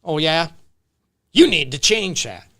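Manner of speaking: same words, aggressive tonality; harsh demanding tone. Shown as a demonstration. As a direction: make it a blunt order. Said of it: "Tone is different".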